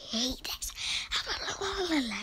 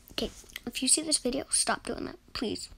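ordinary delivery

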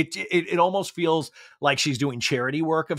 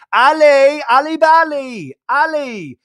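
much higher, loud mock call across the house; 10 semitones higher, 8 dB louder, slower than usual. James calling his girlfriend through the house, loud and high. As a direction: holler for her from another room. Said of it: "James Kennedy going".

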